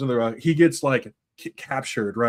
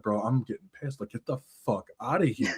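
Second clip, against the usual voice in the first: muted irritation; 4 semitones lower, 6 dB quieter than usual. Acted. Killer annoyed at the intruders.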